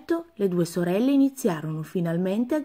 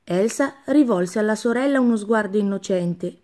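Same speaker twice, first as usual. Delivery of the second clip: ordinary delivery, nothing marked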